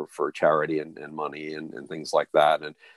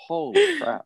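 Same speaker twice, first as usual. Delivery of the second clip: mock amazement. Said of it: "And I was so like".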